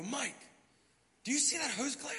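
surprised question. Retold or imitated. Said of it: "I go".

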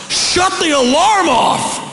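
high-pitched, drawn-out holler; yell through house. Acted. Preacher acting out his yell at the alarm, yelling. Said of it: "I will yell through the house".

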